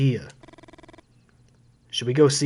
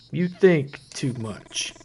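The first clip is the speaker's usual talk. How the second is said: slow second take; 3 dB quieter, much slower than usual. Shown as a demonstration. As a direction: lean into "think", slow and heavy. Repeats line two ways.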